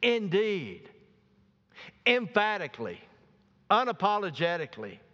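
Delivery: INDEED punched high and emphatic, each word set apart. Real, not acted. Preacher drives home Jabez's word to the congregation, emphatic. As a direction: hammer "Indeed", "Emphatically", "unapologetically" one by one.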